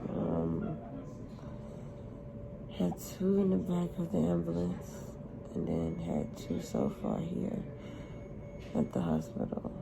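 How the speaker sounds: barely holding it together, medicated; weak after seizures. Real, not acted. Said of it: "Like, I'm weak".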